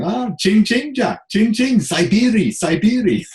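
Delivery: high, loud, drawn-out comic Russian chant; playful Russian jeering; mock Russian accent. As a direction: taunt in a hammed-up Russian accent. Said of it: "about your Russian accent".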